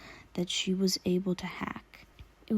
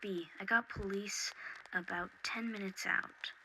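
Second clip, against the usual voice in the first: Oracle over comms; 10 dB quieter than usual. Acted.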